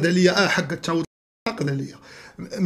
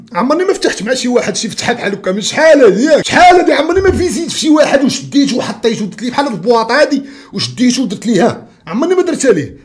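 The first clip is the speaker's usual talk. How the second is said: excited boasting shout; 6 semitones higher, 6 dB louder than usual. Real, not acted. Boasting at rival about past fights.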